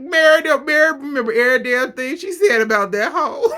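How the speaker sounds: high loud yell through laughter; laughing shout. Real, not acted. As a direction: holler at the screen while laughing.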